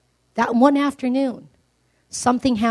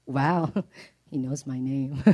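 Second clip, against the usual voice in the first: awed quiet laugh; 9 semitones lower, 5 dB quieter than usual. Acted. Zacchaeus amazed Jesus knows him.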